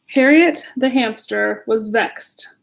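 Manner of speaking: ordinary delivery, nothing marked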